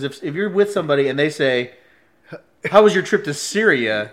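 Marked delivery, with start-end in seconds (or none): 2.7-4.1 loud, higher mock-friendly question; prank announcement. Friend putting him on the radar at the airport, loud prank question. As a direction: call it out so bystanders overhear.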